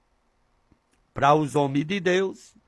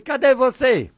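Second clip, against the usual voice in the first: higher, brisk call at ordinary volume; short raised-pitch call, no stretching; calling out to God; 6 semitones higher, 3 dB louder than usual. Retold or imitated. Preacher repeats his prayer question, brief higher call. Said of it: "Desse jeito".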